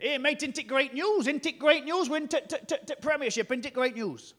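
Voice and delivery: excited Huddersfield fan, high and loud; stammering, overjoyed northern English accent. Retold or imitated. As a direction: gush the good news in broad Huddersfield. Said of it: "that is a very good Huddersfield accent".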